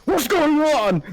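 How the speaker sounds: high loud blurt; blurted shout. Acted. Panicked druid blurting at the bush, high loud shout. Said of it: "shout it almost in a way".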